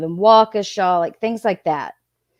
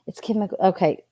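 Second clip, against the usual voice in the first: ordinary talk, nothing marked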